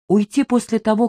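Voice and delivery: ordinary delivery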